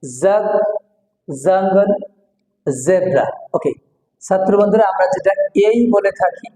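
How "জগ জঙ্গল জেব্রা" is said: "জগ", "জঙ্গল", "জেব্রা" each begun on a held z; word-by-word drill, buzzing the z in "জেব্রা"; buzzed z onset. Shown as a demonstration. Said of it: "এটা sound হলো". (z ধ্বনিতে "জগ", "জঙ্গল", "জেব্রা")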